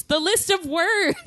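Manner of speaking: high, exclaimed in mock outrage; all-caps exclamation. Reader sharing her all-caps book note, high exclamation. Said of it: "in all caps with two exclamation points".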